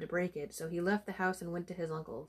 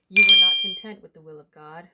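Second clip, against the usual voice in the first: loud, forceful scolding like a sermon; stern rebuke; 18 dB louder than usual. Acted. Priest rebuking John for his pride, stern and loud. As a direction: thunder it at him from the pulpit.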